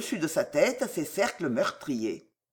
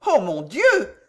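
louder, anxious outcry; alarmed exclamation; 3 semitones higher, 5 dB louder, slower than usual. A narrator's reading. Frightened wife reacts to husband.

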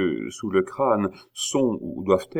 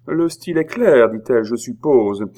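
sharp sarcasm; 5 semitones higher, 8 dB louder than usual. Acted. Queen mocks with the receipt.

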